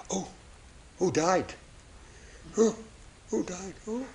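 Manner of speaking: gasping, softly acted dismay; hushed shock. Churchgoers come in and see a coffin, hushed shock. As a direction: ask in a hushed, startled voice. Mourners gasp on entering church.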